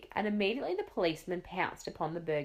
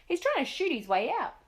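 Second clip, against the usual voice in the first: much higher panicky warning; alarmed; 9 semitones higher, 3 dB louder than usual. A narrator's reading. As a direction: Duke backing away in fright. Duke alarmed after shot.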